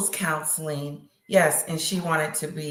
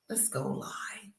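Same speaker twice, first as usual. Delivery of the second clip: softly whispered aside; 6 semitones lower, 14 dB quieter than usual. Real, not acted. Whispering to herself about going live. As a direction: whisper it like a little plot.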